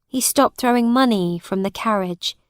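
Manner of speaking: usual speaking voice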